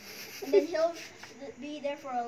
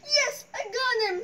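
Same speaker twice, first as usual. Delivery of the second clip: triumphant; 6 semitones higher, 4 dB louder than usual. Retold or imitated. Acting out game catch.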